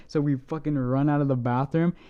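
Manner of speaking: usual speaking voice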